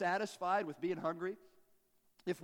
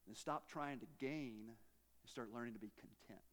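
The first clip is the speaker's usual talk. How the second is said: soft confiding; 7 semitones lower, 10 dB quieter than usual. Acted. Soft punchline of secret.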